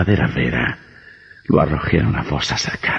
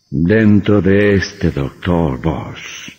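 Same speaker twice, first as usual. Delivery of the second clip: very slow, drawn-out and ominous; vampire drawing out the reveal; slow menace; 3 semitones higher, much slower than usual. Acted. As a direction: stretch each word to chill him. Vampire reveals his true self.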